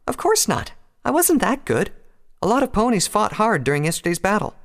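high-pitched modest protest; flustered denial. A narrator's reading. Twilight modestly denies the praise.

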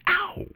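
very high, sharp "Ow!"; yelp of pain. Acted. Stung on the ear while teasing Luna, high pained yelp. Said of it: "She rubbed the back of her ear".

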